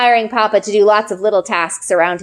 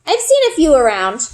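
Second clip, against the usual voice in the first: fake cheer; 5 semitones higher than usual. A narrator's reading. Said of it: "putting as much fake cheer".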